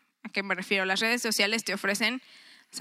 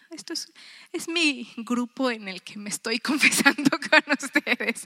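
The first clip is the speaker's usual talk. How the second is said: laughing confession; 3 semitones higher, 3 dB louder than usual. Real, not acted. Confessing her phone habit laughing.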